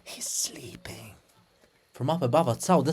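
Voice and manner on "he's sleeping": whispered, slow; hushed. Acted. Mother hushes her daughter beside sleeping father, whispered. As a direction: hush it so the sleeper won't wake.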